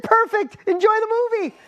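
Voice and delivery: high, loud triumphant bursts; retold jubilant yell; elated relief. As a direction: shout each one out in relief. Dad cheering daughter's answer.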